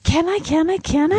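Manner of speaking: high eager pleading; excited begging. Real, not acted. Eager plea for toys.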